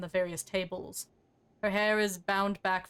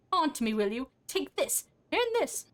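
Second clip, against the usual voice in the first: rising higher with each blow; fighting outburst; 6 semitones higher than usual. Acted. Marta pounds the corpse with her fist, climbing angry cries. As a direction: punch out each phrase, climbing to a high-pitched cry.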